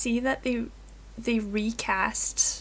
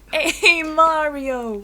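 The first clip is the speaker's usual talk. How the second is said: Mario voice; 6 semitones higher, 7 dB louder, much slower than usual. Pretend. Mario impression.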